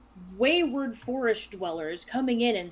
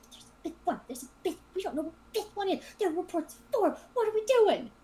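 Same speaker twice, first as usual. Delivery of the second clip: high, hushed, panicky henchman; higher-pitched anxious whine to a partner; nervous, worried; 9 semitones higher, faster than usual. Acted. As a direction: fret urgently under your breath, voice pitched up. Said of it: "looks really, really nervous and really worried".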